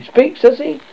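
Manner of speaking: high, suspicious question. A narrator's reading. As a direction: probe with suspicion.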